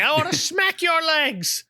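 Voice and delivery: high, stern nanny scolding. Retold or imitated. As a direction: scold like a bossy old nanny.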